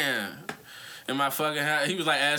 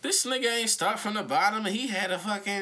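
mocking; 6 semitones higher than usual. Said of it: "we was like".

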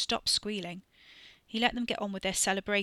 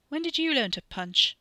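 surprised; 7 semitones higher, 5 dB louder than usual. Acted. Surprised question.

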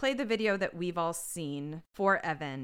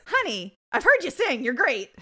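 gushing reassurance; 8 semitones higher, 10 dB louder than usual. Retold or imitated. Gushing at the singer, voiced.

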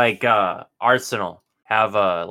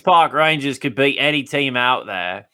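mock British accent; 4 semitones higher, 5 dB louder than usual. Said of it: "I'm getting triggered by your accent".

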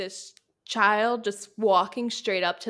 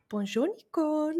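higher, put-on French accent; mock French greeting; 6 semitones higher than usual. Imitating Juan greeting her, put-on French accent. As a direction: imitate a playful French bonjour. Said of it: "he'd be like".

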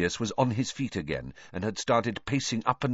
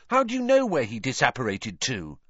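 higher-pitched demanding challenge; sharp retort; 6 semitones higher, 4 dB louder than usual. A narrator's reading. Hermione demanding how Ron knows, retorting sharply. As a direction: press the question, high at the start. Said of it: "Hermione shot back".

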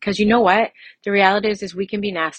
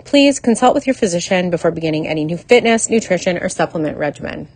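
louder, projected legal announcement; disclaimer read; 6 dB louder than usual. Real, not acted. Podcast host reading the legal stuff to listeners, louder formal read. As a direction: read out the legal notice in a firm, raised voice.

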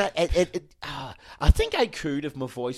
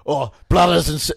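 put-on Scottish accent; 3 semitones higher, 9 dB louder than usual. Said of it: "I'm just doing a Scottish accent".

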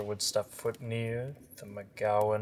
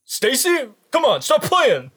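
much higher, louder nervous calls; scared teen calling; 12 semitones higher, 14 dB louder, faster than usual. Acted. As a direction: call out like a spooked teen. Voicing scared Todd.

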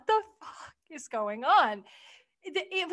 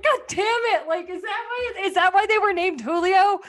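higher, louder disbelieving outburst; exasperated outcry; 6 semitones higher, 4 dB louder than usual. Retold or imitated. Viewer realizing why they were named Julio, high exasperated outcry. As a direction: throw up your hands in mock outrage. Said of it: "so it was just like".

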